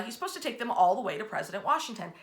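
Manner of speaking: plain reading voice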